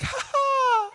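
high-pitched cackle, imitating the lady; hammed-up Jamaican lady's laugh; high mocking laugh. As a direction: laugh loftily in mockery. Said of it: "She starts to laugh".

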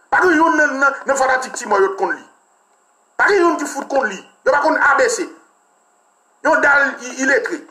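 heated outburst. Real, not acted. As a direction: rail at them, voice climbing high. Ranting at illiterate politicians.

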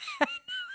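a short high burst of guilty laughter; embarrassed laugh. Real, not acted. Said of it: "I'm laughing 'cause I feel so bad".

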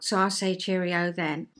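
ordinary talk

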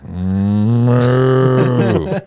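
loud, long mooing call; toy cow moo. Retold or imitated. Teller imitating the cow can in the meeting, loud moo. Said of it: "he goes".

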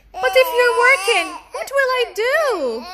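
put-on high, fast, near-tearful little boy; worried child. Daniel nervous about mom's job, high tearful child voice. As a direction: plead like a nervous kid. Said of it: "Daniel asked".

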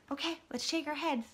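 ordinary delivery

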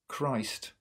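ordinary talk

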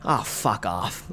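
low, soft, slow fed-up groan; quiet disgusted mutter. Real, not acted. Disgusted reaction to comment.

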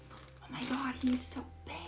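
softly whispered in awe; hushed wonder; awed whisper. Real, not acted. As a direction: breathe it out in a whisper.

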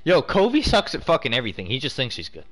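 ordinary talk

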